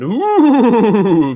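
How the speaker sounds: spooky ooh breaking into a laugh; ghostly ooh, then crack up laughing. Pretend. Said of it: "That was my spooky voice".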